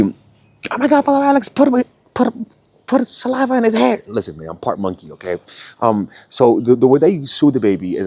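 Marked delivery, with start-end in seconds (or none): 0.0-4.0 high excited mock voice. Parent cooing over a baby, high gushing falsetto. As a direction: gush in a squeaky high voice. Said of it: "it's through literally".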